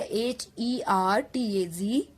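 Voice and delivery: H E R T A जी said letter by letter, slowly; spelling out letters. Shown as a demonstration. Teacher spelling the unit for students, letter by letter. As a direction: spell out H E R T A जी one slow letter at a time. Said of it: "spelling out hertz letter by letter".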